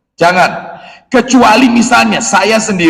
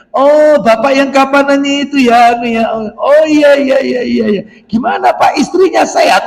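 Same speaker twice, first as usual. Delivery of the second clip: higher, eager gushing voice; over-friendly caller; 7 semitones higher than usual. Acted. Man calling a friend's wife, over-eager and pitched higher. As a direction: gush overfamiliarly, pitched up.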